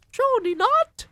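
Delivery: very high, loud witch shout. Acted. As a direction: shriek in disbelief. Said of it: "the others shouted".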